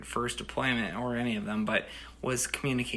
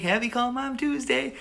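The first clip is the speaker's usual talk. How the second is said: much higher, changed voice as mom; imitating mom; 12 semitones higher than usual. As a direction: sing it back like mom would. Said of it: "She'd be like".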